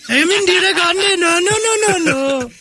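very high, put-on wailing voice; high, keening caricature of an old woman; Indira Gandhi. Comic showing co-hosts the impression, mock high wailing. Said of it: "That's my Indira Gandhi impression".

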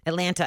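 "Atlanta" with the second T clearly sounded; Atlanta with T's. Shown as a demonstration. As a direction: say "Atlanta" the outsider's way, T's intact. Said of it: "I say Atlanta".

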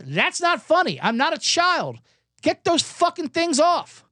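comic fed-up patient telling the doctor off; mock outrage. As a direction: spit it out fed up. Ranting at doctor's novelty antlers.

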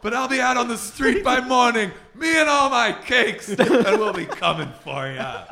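booming mock villain vow with a laugh; hammy stage actor. Cake boss threat bit.

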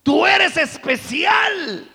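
mock flattering plea, high and loud, slowed down; hammed-up smooth-talker flattery; sweet talk. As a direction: abuser sweet-talking his girlfriend, mock flattery. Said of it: "le dice".

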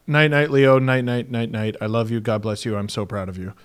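soft, low, fading to near-murmur; tender goodnight. Retold or imitated. Dad putting young son to bed, tender and hushed.

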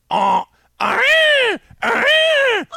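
piercing high shrieking bird call, far above the voice; loud imitated peacock cry; peacock honk scream. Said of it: "you get".

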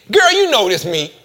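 high, loud, casual recognition; playful, familiar exclamation; switched-up familiar voice. Acted. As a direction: drop the formality and call out to a friend. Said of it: "you gotta switch it up".

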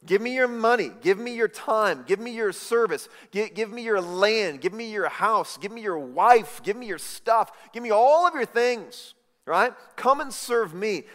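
loud, greedy demands in a high voice; demanding worldly king. Retold or imitated. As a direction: bark out every demand like a grasping ruler. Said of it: "they say".

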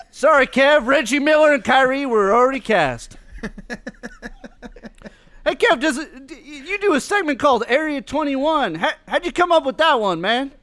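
ordinary talk

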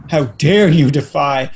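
slow, dramatic mock indignation; over-the-top "how dare you"; mock outrage. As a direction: ham up the offended purist. Offended person, mocked.

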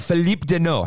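French-style Philippe Danault, stressed on Philippe; host showing off his French; trying a French accent. Shown as a demonstration. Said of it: "how's my French tongue?".

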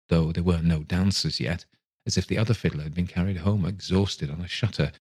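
plain reading voice

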